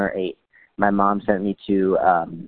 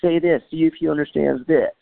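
high-pitched imitation of eager kids; excited kids; 8 semitones higher than usual. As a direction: mimic kids daring each other. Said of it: "they're being kids".

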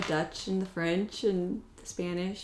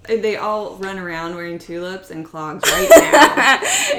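dry sarcastic joke, louder and laughing; 2 semitones higher, 13 dB louder than usual. Acted. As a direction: say it with a wink. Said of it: "are you being serious or being sarcastic".

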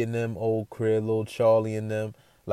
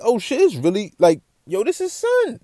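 amazed; 11 semitones higher, 7 dB louder than usual. Retold or imitated. Realising the man is for real.

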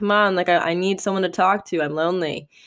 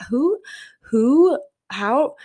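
much higher, stammering astonishment; amazed disbelief; 8 semitones higher, much slower than usual. Retold or imitated. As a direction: sputter like you can't believe it. Amazed at his skill.

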